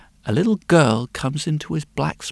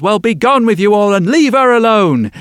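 stern dismissal; 4 semitones higher, 7 dB louder than usual. Acted. Blacksmith chases boys away.

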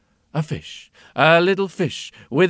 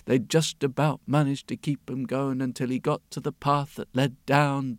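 ordinary delivery, nothing marked

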